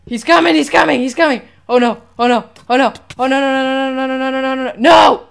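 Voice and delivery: frantic, rising panic; panicked yelling. Real, not acted. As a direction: shout it in terror. Panic as enemy approaches.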